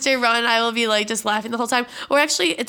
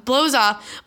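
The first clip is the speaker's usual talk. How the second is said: ordinary talk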